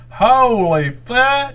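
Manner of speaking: high, loud, long-drawn exclamation; guy in the background. Retold or imitated. Co-host mimicking the guy in the jump video, high drawn-out cry. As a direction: imitate a stunned onlooker, stretched out high. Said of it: "The guy in the background said".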